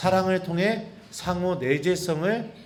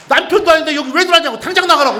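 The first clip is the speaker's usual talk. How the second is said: much higher, louder, fast furious yell; angry order out; 9 semitones higher, 11 dB louder, faster than usual. Retold or imitated. Doctor yelling at the priest to get out. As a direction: throws him out at once.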